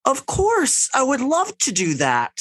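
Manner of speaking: put-on very high, sweet little-boy voice; high, eager child. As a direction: imitate a polite little kid. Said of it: "her little boy goes".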